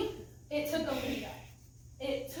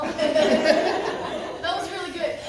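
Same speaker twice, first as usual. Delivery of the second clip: laughing; 3 semitones higher, 8 dB louder, much slower than usual. Real, not acted. Laughs while praising.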